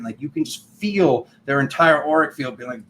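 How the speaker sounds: ordinary talk, nothing marked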